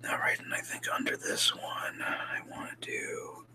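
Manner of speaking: soft whispering to self; leaning in to whisper. Real, not acted. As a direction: whisper it as if talking to yourself. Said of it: "I'm just gonna go to a whisper voice now".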